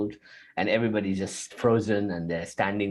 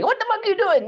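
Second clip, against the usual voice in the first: mock outraged scold, much higher and louder; harsh inner scold; 4 dB louder, much faster than usual. Inner critic snapping at a straying self, high mock outrage. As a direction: snap at yourself like a furious inner critic. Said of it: "pulling you back and saying".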